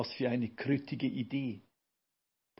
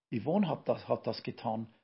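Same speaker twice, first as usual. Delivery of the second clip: ordinary delivery, nothing marked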